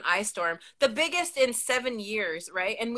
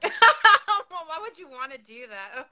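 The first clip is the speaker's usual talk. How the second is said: laughing disbelief; 5 semitones higher, 10 dB louder, slower than usual. Real, not acted. Laughing disbelief at winter wish.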